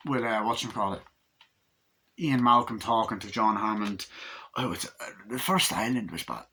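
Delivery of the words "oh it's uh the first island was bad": quiet, fumbled character voice; low-key failed impersonation; botched impression. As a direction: try out a movie voice half-heartedly. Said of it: "What the fuck was that?".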